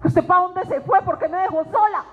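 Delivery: mock-tearful wail, rising high; tearful pleading. Speaker re-enacts crying to God alone in the car, tearful pleading. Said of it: "y a llorar".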